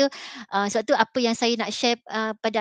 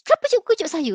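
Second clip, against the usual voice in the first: imitated angry pupil, much higher and louder; angry student; 9 semitones higher, 4 dB louder, faster than usual. Teacher voicing the angry student woken in class. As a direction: throw the question back at the teacher, indignant. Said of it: "dia marah saya".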